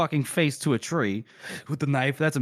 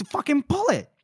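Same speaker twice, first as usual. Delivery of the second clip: high, forceful mock yell; acted urgent outburst; impatient command; 14 semitones higher than usual. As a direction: order it out in exasperation. Impatient companion orders arrow pulled.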